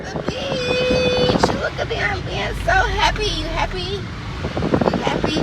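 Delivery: thrilled to reach the beach; excited pet-talk. Real, not acted. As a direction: gush at the dog in a high voice. Excited arrival with dog.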